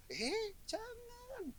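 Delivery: high, very slow drawn-out no; playful long high warning; mock warning. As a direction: stretch it out like a teasing scold. Preacher cutting off a bad thought.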